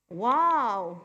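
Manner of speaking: loud, drawn-out admiring wow; long, loud, appreciative exclamation; admiring young man. Acted. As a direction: play a man wowed by a pretty girl. Acting young man admiring a girl.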